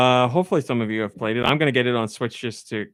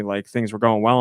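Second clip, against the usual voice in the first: normal talking voice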